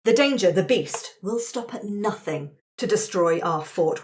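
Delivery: normal talking voice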